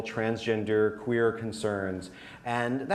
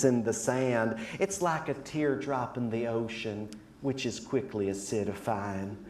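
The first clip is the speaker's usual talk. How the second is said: despair voice. Acted. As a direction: sigh it out like a lost prophet. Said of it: "And this is my despair voice".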